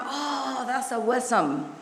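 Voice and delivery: "awesome" said as uh-WESS-um, in a soft exclamation; mispronounced awesome. Retold or imitated. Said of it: "I said all the time".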